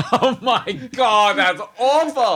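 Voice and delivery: high, loud laugh through the words; laughing groan. Real, not acted. Groaning with laughter at her co-host's terrible pirate joke.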